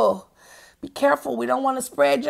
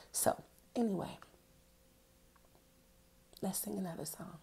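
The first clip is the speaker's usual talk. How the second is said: whisper; 4 semitones lower, 18 dB quieter, faster than usual. Real, not acted. Whispered aside.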